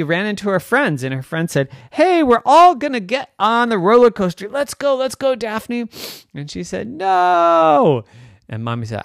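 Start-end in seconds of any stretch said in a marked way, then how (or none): 1.9-5.9 put-on high, loud eager kids; rally a friend to come along; excited friends. Excited friends call Daphne. 6.9-8.0 high, loud drawn-out no; emphatic refusal. Acted. Daphne refuses friends.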